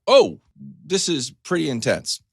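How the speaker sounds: high, loud sudden oh; startled realization. Retold or imitated. Patient facing surgery, startled aloud. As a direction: jolt at how serious it is.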